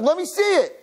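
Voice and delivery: excited bride; eager demand. Retold or imitated. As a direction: squeal for the present like an excited bride.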